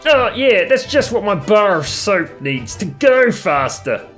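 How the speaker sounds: usual speaking voice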